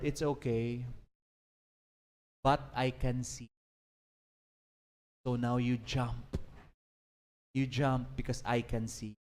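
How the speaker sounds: put-on steady the frightened child gently; calm father. Father gently reassures his daughter he can see her, soft and low. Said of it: "Then her father said".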